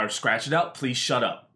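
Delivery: normal talking voice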